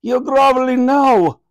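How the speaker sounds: high, loud royal scolding; queen scolding granny's groveling; haughty queen. A narrator's reading. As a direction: scold them from a great height. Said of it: "replied the Queen".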